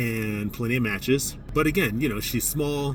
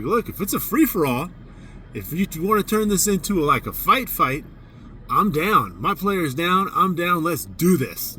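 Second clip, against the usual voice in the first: defiant call-out; 6 semitones higher than usual. Retold or imitated. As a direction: throw down a challenge, half joking. Coach taunting the referees loudly.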